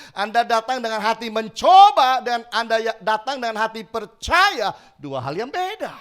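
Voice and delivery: loud, high preaching build; preaching at full force. Real, not acted. Preacher to the congregation, loud and high. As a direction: drive the point home to the congregation.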